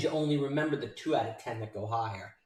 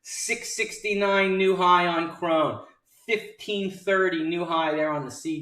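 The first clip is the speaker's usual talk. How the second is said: excited announcement; 3 semitones higher, 6 dB louder than usual. Real, not acted. Announces a new high.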